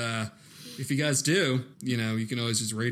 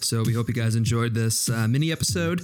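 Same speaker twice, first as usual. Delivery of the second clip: podcast outro read; 4 semitones lower, 5 dB louder than usual. Acted. Inserted outro over music.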